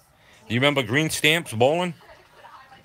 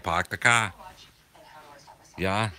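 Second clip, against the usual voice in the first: "park the car" again as "pahk the cah", r-less Boston accent. Shown as a demonstration. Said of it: "That's how we say it".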